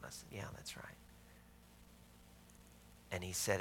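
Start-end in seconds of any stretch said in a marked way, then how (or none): 0.3-1.0 whispered yes, barely voiced; hushed reply. Retelling his answer to the older gentleman, whispered. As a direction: answer under your breath, half-embarrassed.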